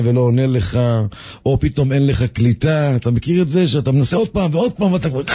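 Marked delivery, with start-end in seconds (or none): none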